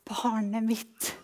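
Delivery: slow, gentle voicing of God; hushed, tender reply; God's quiet answer. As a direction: answer softly and unhurriedly, as to your child. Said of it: "når jeg blir stille, så svarer han".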